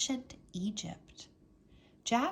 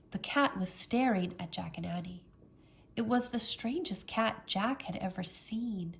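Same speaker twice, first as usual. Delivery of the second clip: plain reading voice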